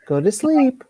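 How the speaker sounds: high, gentle pet talk; talking to a dog. Real, not acted. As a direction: coax the dog to sleep. Soothing the dog to sleep.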